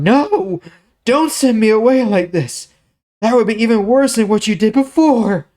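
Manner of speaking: very high, loud, distraught begging; anguished plea. Acted. Tamar begging Amnon not to send her away, high and anguished. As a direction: cry out against being thrown out.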